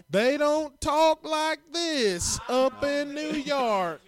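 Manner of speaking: high, slow put-on Southern drawl; mock Southern accent. Comic mocking a politician's borrowed accent, high Southern drawl. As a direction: draw it out in an exaggerated Southern accent.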